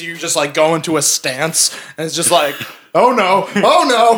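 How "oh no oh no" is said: high, loud rising oh no; dread. Acted. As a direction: panic as it dawns. Character dreading the stance.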